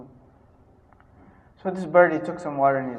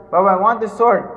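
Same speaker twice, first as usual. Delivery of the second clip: put-on child begging; 4 semitones higher, 10 dB louder than usual.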